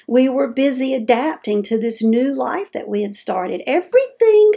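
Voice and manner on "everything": everything jumps up high and loud, bright with excitement; Everything, high and loud with delight; excited burst. Real, not acted. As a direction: fling out Everything, much higher and louder. Emphatic repeat of a word.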